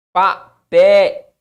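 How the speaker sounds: "Papéis" slowly cut into pa... PÉ... i; split in three syllables. Shown as a demonstration. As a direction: say "Papéis" syllable by syllable, loud PÉ, detached ending. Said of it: "é esta separação ou papéis". ("Papéis" pausado em três sílabas, pa-PÉ-is, com força no PÉ)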